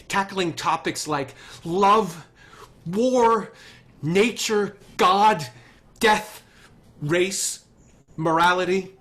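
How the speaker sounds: a pitch swelling into a crescendo; passionate build. Acted. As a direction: hammer every topic home with rising passion. Gene's passionate pitch climax.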